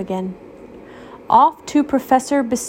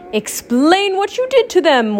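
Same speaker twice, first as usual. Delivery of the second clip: higher, insistent demand; 9 semitones higher than usual. Acted. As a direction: demand an answer from the professor. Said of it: "Waffle demanded".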